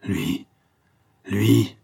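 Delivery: a hint of dread in the cry; understated terrible exclamation. A narrator's reading. Villainess spotting him through binoculars, mild dread. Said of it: "fait-elle avec un accent terrible".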